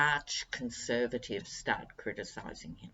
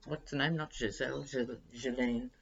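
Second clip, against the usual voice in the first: usual speaking voice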